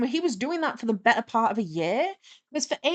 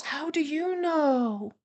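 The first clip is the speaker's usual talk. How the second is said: high, drawn-out mock wonder; imitated amazement; 6 semitones higher, much slower than usual. Clueless flatmate asked about his stinking clothes, in mock amazement. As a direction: stretch it out like a clueless, awestruck flatmate.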